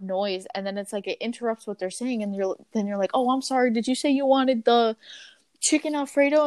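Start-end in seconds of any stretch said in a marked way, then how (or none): 3.1-6.5 apologetic check-back. Retold or imitated. Voices herself asking phone customer.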